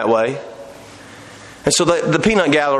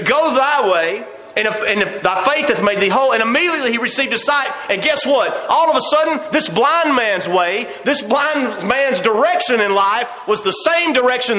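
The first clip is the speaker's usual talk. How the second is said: fervent preaching; 9 semitones higher than usual. Acted. Preacher on blind Bartimaeus clinging to faith, fervent preaching. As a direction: drive each point home with rising fervor.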